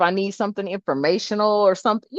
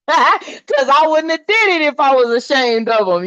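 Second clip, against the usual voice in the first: laughing through the words, higher and louder; real laugh mid-sentence; 6 semitones higher, 5 dB louder than usual. Real, not acted. Laughing as she tells viewers she owns her heyday.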